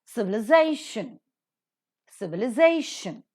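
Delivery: "Civilization" stressed on ZA; stress before the suffix. Shown as a demonstration. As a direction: punch ZAY in each "Civilization". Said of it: "these words that are pronounced".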